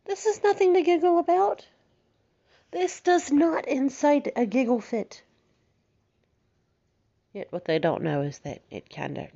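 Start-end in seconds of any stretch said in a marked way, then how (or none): 0.0-5.2 much higher, mocking voice of a puzzled boyfriend; mock self-conscious guy. Imitating a self-conscious boyfriend, mocking and much higher. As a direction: play the offended man, pitched way up. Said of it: "be like".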